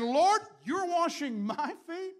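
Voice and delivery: high, loud, slow cry of disbelief; Peter protesting. Acted. Peter shocked as Jesus washes his feet, high and loud. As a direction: Peter can't believe what's happening.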